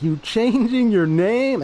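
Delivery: high, drawn-out mock outrage. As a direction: exclaim it high and slow, half amused. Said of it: "I'm laughing about it too".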